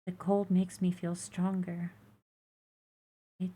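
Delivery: put-on high, soft, faint baby creature; small high creature voice. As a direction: plead quietly like a fragile hatchling. Egg creature voice.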